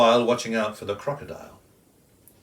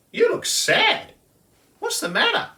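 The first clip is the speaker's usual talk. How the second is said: concerned kookaburra; 5 dB louder than usual. Acted. Kookaburra asking the sad kangaroo, high concern. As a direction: ask in a high birdy voice.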